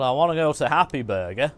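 ordinary talk

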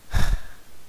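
long unvoiced sigh of resignation; weary sigh. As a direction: let out a tired, defeated breath as Aquaman. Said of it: "Aquaman would be like".

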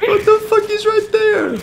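very high-pitched disbelief; shocked outburst. Real, not acted. Streamer stunned to find the player beside him, shocked outburst. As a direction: squeal it high in amazement.

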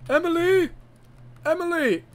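high, loud call of a name. Acted. Character calling out for Emily, high loud shout. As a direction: shout as if across a distance.

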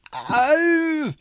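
sharp high yelp of pain; a sudden stung cry, high up; howl of pain. Acted. Boy hit by a spark, yelping in pain. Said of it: "Ivar hyler av smerte".

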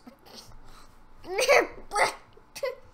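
high laugh. Real, not acted. Laughing at the opponent.

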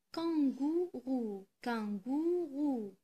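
"Kangourou" drawn out in three long syllables; slow syllable-by-syllable. Shown as a demonstration. Teacher helping pupils locate a sound, "kangourou" slowly sounded out. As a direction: stretch every syllable of "kangourou". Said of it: "Je répète".

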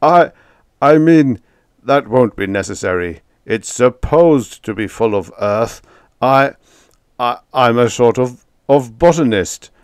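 loud, stammering, frightened voice; flustered fear. A narrator's reading. Tenant stammers a panicked excuse to his landlady. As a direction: stumble over the excuse in panic. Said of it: "in an almost terrified voice".

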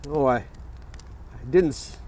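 normal talking voice

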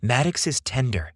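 plain reading voice

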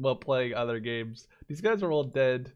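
ordinary talk

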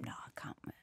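a breathy no to the agent; whispered refusal. Retold or imitated. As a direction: whisper it, no voice at all. Refusing her agent, retold.

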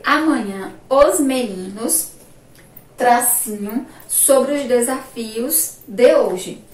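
measured, spaced-out sentence reading; slow dictation. Shown as a demonstration. Teacher reading the exercise sentence aloud, slow dictation. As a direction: read it slowly, phrase by phrase.

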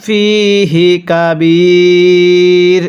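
ফিহি কাবীর drawn out slowly, pausing on কাবীর; slow recitation stop. Shown as a demonstration. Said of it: "আর একবার আমার সাথে পড়বেন". (ধীরে ওয়াকফ করে ফিহি কাবীর)

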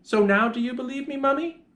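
put-on high-pitched, triumphant child; child's excited cry. A narrator's reading. Child proving the tummy beast to Mummy, excited cry. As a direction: exclaim as a child proved right. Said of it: "I cried".

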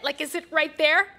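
normal talking voice

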